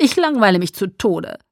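loud and eager, swooping pitch; enthusiastic. A narrator's reading. Emmett itching for action out of boredom, enthusiastic. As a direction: burst out with restless enthusiasm. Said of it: "sagte Emmett begeistert".

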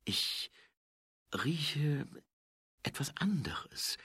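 halting near-whisper with long pauses; distracted, hushed. A narrator's reading. Angua catching another scent, hushed and halting. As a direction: break it up softly, as if sniffing the air.

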